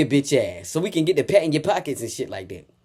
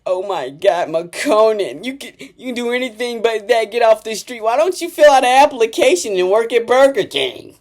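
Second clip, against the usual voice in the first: higher, lightly mocking outcry; mock outraged critic; 6 semitones higher, 3 dB louder than usual. Imitating critics begging a rapper to get off the street, mock outrage. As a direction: build into a high, loud mock plea. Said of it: "you be like".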